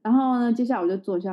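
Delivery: ordinary talk, nothing marked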